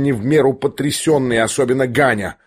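ordinary delivery, nothing marked

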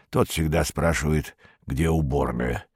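put-on low-pitched, soft elderly voice; old man. A narrator's reading. Old man asks Perets about his car, low and aged. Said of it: "сказал справедливый старик".

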